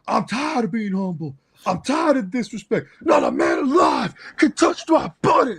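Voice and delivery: high, shouted wrestler promo; Roman Reigns. Retold or imitated. Fan imitating wrestler's promo after a match, shouted outrage. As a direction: build to a loud, strained boast. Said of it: "after the Logan Paul thing, he's all like".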